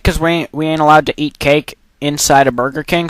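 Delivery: ordinary delivery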